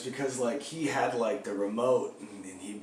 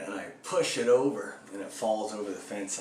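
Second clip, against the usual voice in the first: ordinary talk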